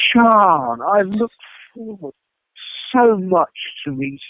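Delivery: mock-gushing rock star; gushing charmer. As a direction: lay on the charm, slow and high. Smarmy turnaround greeting in anecdote.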